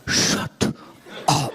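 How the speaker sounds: whispered, hissing "Shut up"; mom's last icy warning through tight lips; mom's final warning. Retold or imitated. Mom silencing arguing kids, with a whispered "Shut up". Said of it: "Till finally she'd go".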